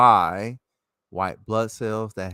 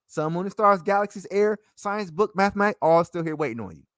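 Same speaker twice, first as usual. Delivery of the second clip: ordinary talk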